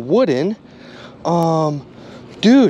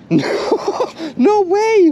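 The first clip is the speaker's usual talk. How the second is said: high-pitched, rising "no way"; thrilled and amazed at the find; amazed disbelief; 12 semitones higher, slower than usual. Real, not acted. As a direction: squeal in happy disbelief.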